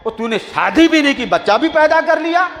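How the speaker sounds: rising, high outraged question; angry crowd. Retold or imitated. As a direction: confront him with disbelief. Angry crowd confronting Jureij. (غصے سے بھرا سوال)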